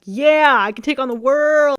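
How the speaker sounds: loud, hammed-up confidence burst; mock pumped-up. Imitating herself after therapy, loud and pumped. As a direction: shout it like you're invincible. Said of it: "I'm like".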